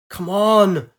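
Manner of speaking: loud, high strained urging; will the ship to hold on. Acted. Pilot yelling at her breaking fighter, strained.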